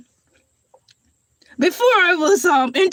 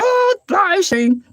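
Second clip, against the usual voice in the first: usual speaking voice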